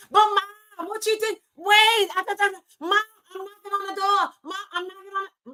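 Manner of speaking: put-on child knocking on the door, calling for Mom; whiny kid interrupting. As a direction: play the kid at the door begging for attention. Voicing her child interrupting her.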